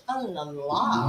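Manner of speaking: soft, slow mock French oh la la; French accent. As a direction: purr a French accent, trailing low and slow. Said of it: "You go like some French".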